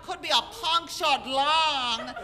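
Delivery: loud, hammed-up Germanic doctor; German-sounding accent. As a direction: boom it out in a mock German accent, drawing out words. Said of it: "all I hear is German".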